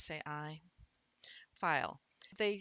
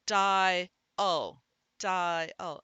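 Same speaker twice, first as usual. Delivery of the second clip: "dial" loud and stretched into die-ul; second syllable emphasized; 9 dB louder than usual. Shown as a demonstration. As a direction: say "dial" in two separate beats, leaning on the ul. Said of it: "and really emphasize the second syllable".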